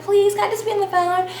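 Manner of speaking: high, begging plea; pleading friend. Retold or imitated. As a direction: mimic her whining to stay on the line. Friend begging to stay on call.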